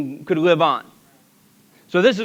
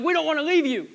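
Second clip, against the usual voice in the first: tearful plea; 8 semitones higher, faster than usual. Acted. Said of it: "They started crying".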